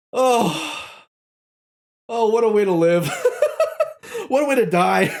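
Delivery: high, giggling wistful remark; laughing sigh. Real, not acted. Wrapping up the ghost story, high and laughing. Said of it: "laughing reflection".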